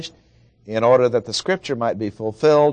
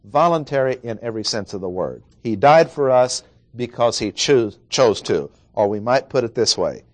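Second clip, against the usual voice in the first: normal talking voice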